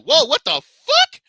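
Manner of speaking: high, slow, shouted whoa what the fuck; stunned yelling at the screen; shocked disbelief. Retold or imitated. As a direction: screech out your shock at the replay. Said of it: "I'm like".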